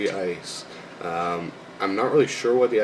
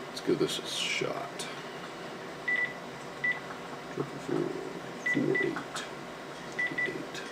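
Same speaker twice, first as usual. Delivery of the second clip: muttering; 13 semitones lower, 11 dB quieter than usual. Real, not acted. Dialing phone, muttering numbers.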